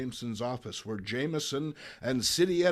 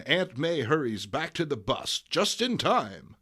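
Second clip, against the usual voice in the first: ordinary talk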